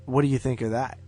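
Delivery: usual speaking voice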